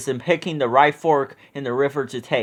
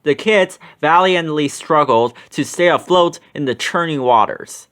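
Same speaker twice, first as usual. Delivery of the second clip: ordinary delivery, nothing marked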